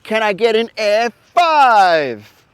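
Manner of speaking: bright, high, loud host greeting; kids show host. Acted. Kids show host greeting his young viewers, bright and loud. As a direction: beam at the kids like a TV presenter.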